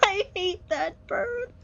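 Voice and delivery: high, whiny near-crying complaint; tearful frustration. Real, not acted. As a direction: whine it as if close to tears. Fed up with the game.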